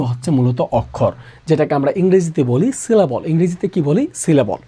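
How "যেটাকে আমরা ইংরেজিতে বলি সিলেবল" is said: normal talking voice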